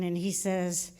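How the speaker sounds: ordinary talk, nothing marked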